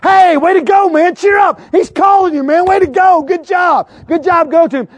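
high, loud encouraging cheers; crowd cheering. Retold or imitated. Fickle crowd now cheering the blind man toward Jesus, excited. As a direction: whoop him on like a fan.

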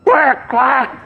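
high squawked mock cricket; goofy high-pitched animal squawk; pretend cricket noise. Shown as a demonstration. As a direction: squawk out a silly fake cricket chirp. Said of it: "pretend they sound like this".

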